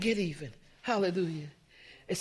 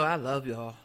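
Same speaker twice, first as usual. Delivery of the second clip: low, quiet and lifeless; quiet, hollow, going through the motions; flat, unconvincing; 9 semitones lower, 8 dB quieter than usual. Shown as a demonstration. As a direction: mumble it like you don't mean it. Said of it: "Does that sound like I love y'all? ... inflections in my voice".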